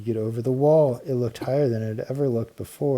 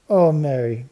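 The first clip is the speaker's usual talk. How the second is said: a higher, much louder delighted gasp; excited cry; 4 semitones higher, 8 dB louder than usual. A narrator's reading. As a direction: cry out in happy surprise. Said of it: "cried Babs".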